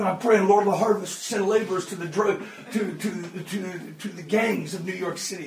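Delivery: low, soft murmured answer; hushed, low prayerful reply; quiet prayer. Retold or imitated. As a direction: answer the police softly, still praying. Praying man answers police.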